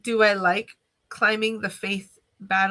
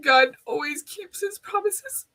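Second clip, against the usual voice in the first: higher, tearful, voice catching as she repeats it; fighting back tears on the promise; choked up; 6 semitones higher than usual. Real, not acted. Repeating the lesson to viewers, now crying. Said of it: "I'm sorry for crying".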